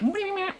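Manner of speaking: high squeaky anteater snuffle; anteater noise. Retold or imitated. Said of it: "That's a really good Anteater impression".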